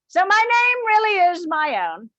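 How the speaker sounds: ordinary delivery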